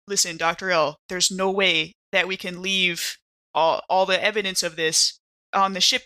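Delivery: ordinary talk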